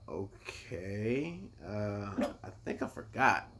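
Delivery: slow, uncertain "okay", then a quiet admission; baffled hesitation. Retold or imitated. Soldier finds the wheel on the wrong side, baffled hesitation. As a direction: stall softly, thrown off. Retold baffled reaction at the van.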